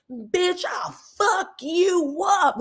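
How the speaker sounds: high, slow mock threat; mock furious threat. Simone flinging her body at Heavenly, hammed-up threat. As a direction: ham up a wild fighter's threat. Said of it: "doing all of that herky jerky shit".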